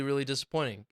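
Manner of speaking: normal talking voice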